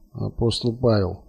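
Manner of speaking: soft, muttered grunt. A narrator's reading. Colonel giving a password at the intercom, grunted. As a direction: grunt it out quietly. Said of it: "буркнул Станислав Борисович".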